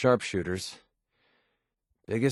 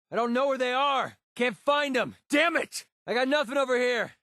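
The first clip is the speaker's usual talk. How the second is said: high-pitched yelling in frustration; exasperated combat yell; frustrated shout; 12 semitones higher than usual. Acted. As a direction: call out across a firefight. Searching for lost enemies, calling out.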